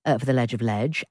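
normal talking voice

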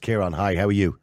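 ordinary delivery

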